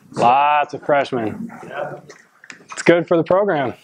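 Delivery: usual speaking voice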